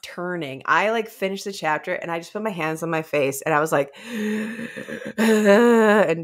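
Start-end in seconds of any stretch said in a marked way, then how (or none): 4.0-5.1 sharp gasp of shock. Retold or imitated. Reader finishing a spooky chapter gasps in shock. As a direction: gasp like the chapter just hit you.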